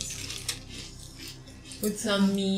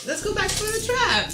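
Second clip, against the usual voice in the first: excited; 13 semitones higher than usual. Acted. Player suddenly remembers her trap.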